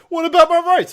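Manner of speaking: loud, high indignant orc; mock outraged yelp; protesting orc. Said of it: "an orc was like".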